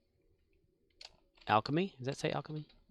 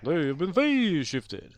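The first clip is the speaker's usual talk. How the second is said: mock game announcer; 2 semitones higher than usual. Continues announcer parody.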